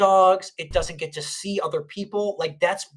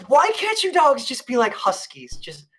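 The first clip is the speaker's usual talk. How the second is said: exasperated plea; 5 semitones higher than usual. Retold or imitated. Pleading with unfriendly dogs.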